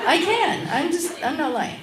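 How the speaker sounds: boasting about her sewing; emphatic boast. Real, not acted. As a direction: insist proudly. Bragging about her sewing skill.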